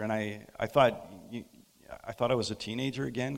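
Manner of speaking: usual speaking voice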